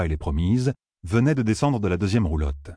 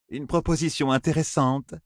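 lightly voiced, melodious woman; musical woman's voice; 6 semitones higher than usual. A narrator's reading. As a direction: savour the offer, musically. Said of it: "dit-elle de sa voix musicale".

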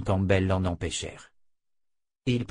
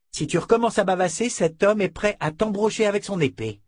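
scornful henchman; 8 semitones higher than usual. A narrator's reading. Fitch berating the tearful noblewoman, scornful and cold. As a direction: sneer at her to clean herself up.

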